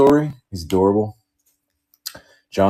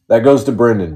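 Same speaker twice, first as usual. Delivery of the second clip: normal talking voice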